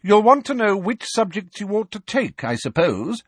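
a hint of a brisk, stern professor. A narrator's reading. McGonagall voiced in story.

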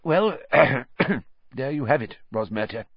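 choked minister clearing his throat; said thickly. Acted. Minister wraps up the sad tale, thick-voiced. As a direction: push words past a thick throat. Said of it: "said Fudge thickly".

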